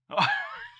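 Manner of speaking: very high, sharp burst of laughter; a surprised squeal of a laugh; startled laugh. Real, not acted. Seeing the wrong Quinn baby image, a high surprised laugh.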